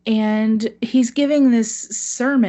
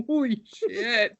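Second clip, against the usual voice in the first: stunned; 3 semitones higher, slower than usual. Retold or imitated. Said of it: "that's my reaction too, is like".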